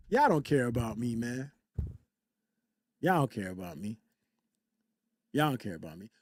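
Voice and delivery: low, soft, downcast grumble; mock hurt. Streamer moping at chat that nobody cares, mock hurt. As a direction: play wounded, low and quiet.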